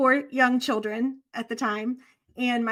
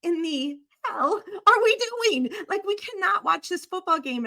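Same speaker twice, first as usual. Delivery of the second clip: exasperated outburst; 6 semitones higher than usual. Retold or imitated. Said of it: "And I would be like".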